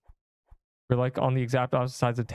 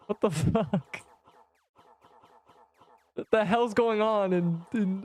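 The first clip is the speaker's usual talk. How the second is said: high, laughing what the; stunned laugh; 7 semitones higher, slower than usual. Real, not acted. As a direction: cry out in amused shock. Stunned by chat roast.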